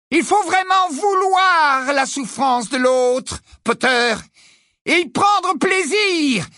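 high, slow, gloating witch; cruel witch voice. A narrator's reading. Bellatrix lecturing Harry in a high, gloating witch voice. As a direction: savour each word like a cruel teacher.

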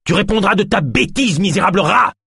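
high, shrieking rage; furious shout. A narrator's reading. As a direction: scream insults at the guard. Healer berates the guard.

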